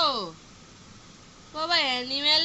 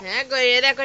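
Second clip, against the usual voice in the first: ordinary talk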